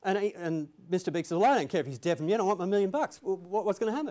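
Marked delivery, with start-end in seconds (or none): 1.4-4.1 higher, louder mock-indignant gangster; agitated demand. Mister Big tells the lawyer he wants his money, agitated. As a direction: act out an impatient crime boss wanting his money.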